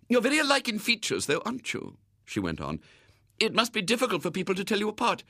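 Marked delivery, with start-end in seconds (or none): 0.1-2.0 high, quick lady's voice; chatty lady. A narrator's reading. Lady remarks on the twins' likeness, chatty. 3.4-5.2 high, quick lady's voice; chatty lady. A narrator's reading. Lady remarks on the twins' likeness, chatty.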